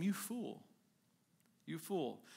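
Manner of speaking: softly spoken, understated reproach; hushed acted reproach; quiet rebuke. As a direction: drop the volume for a grave rebuke. God's rebuke to the rich man.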